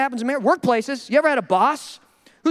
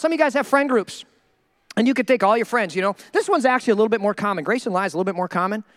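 ordinary talk, nothing marked

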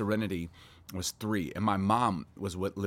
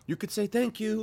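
pointed hint; 14 semitones higher, 5 dB louder than usual. Retold or imitated. Pointed remark to other parents' kids.

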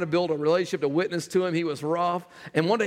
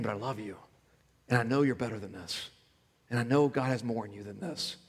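tender parent; 5 semitones lower, 5 dB quieter than usual. Acted. Parent reassuring child softly.

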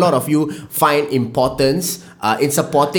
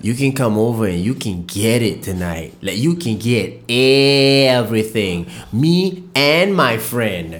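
low seductive come-on; 8 semitones lower, slower than usual. Retold or imitated. Seductive invitation voiced in hypothetical.

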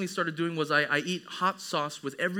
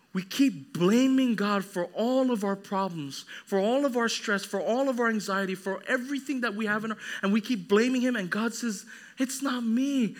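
raised pitch, insistent sermon delivery; forceful preaching; 6 semitones higher than usual. Acted. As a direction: preach it as God's rebuke to the people.

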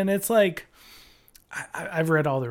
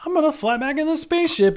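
higher mocking Leia voice; mock her as she floats back in; 10 semitones higher, 5 dB louder, faster than usual. Mocking Leia flying back through space, higher mock voice. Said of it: "she's just like".

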